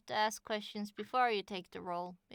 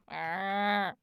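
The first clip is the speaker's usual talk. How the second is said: zombie groan. Pretend. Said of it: "When [name] sounded like a zombie?".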